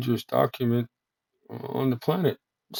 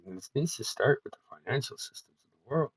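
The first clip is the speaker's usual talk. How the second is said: ordinary delivery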